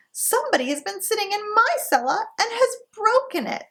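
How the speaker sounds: put-on very high tiny baby bear; baby bear's high wee voice; little small wee voice. A narrator's reading. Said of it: "in his little small wee voice".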